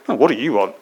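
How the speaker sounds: short, grumpy demand, hammed up; mock grumpy. Preacher jokes how Jesus didn't ask, mock grumpy. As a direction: put on a curt grump. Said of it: "he's not in a grump".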